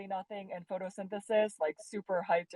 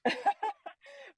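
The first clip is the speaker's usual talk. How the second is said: high, loud burst of laughter; real laugh; 6 dB louder than usual. Real, not acted. Guest cracks up at the host's interjection, laughing high. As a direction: laugh out loud, pitched well up.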